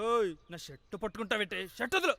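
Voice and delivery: loud, alarmed indignation; indignant protest. Acted. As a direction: demand to be let go. Scuffle, shirt grabbed.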